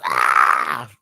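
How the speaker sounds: loud, drawn-out fan scream; excited scream. Real, not acted. Fan overwhelmed by the coming competition, excited scream. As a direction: burst out in an excited yell. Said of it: "I'm just screaming right now".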